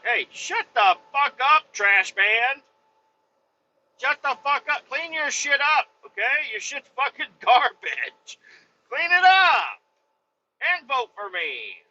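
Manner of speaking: Trump impression. Imitating the president telling the Puerto Rico leader to shut up, brash and mocking. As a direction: bark it at him like a brash president. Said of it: "That's what Trump should say".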